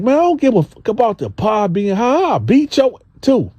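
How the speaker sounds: high, heated mock threat; Gene voiced threatening. Gene threatening the homeboy about the hot pie, high and heated. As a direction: play Gene threatening to fight, pitched high. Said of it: "Gene like".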